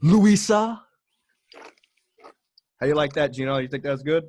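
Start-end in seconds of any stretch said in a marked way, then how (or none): 0.0-0.8 trash-talk call out. Acted. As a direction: hype it up like a boxing promo, taunting. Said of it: "do the official call out now".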